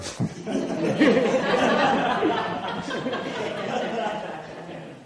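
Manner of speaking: long laugh pitched high. Real, not acted. Telling a caller the punchline, a long high laugh. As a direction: laugh it off at the memory. Laughs after the judge's line.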